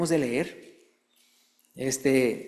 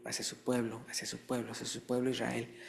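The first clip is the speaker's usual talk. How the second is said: fading emphasis; 4 semitones lower, 10 dB quieter than usual. Acted.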